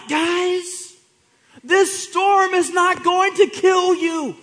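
Jesus shouting encouragement into the storm; joyful shout. Acted. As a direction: cheer the frightened disciples up with big energy. Preacher voicing Jesus, exuberant.

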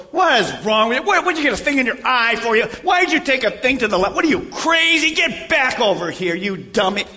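loud, rough mock scolding; harsh scolding. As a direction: berate them like a fool. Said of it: "am I to go to the next person and go".

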